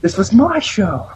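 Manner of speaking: high, hammed-up wail of protest; high-pitched melodramatic yell; mock screaming.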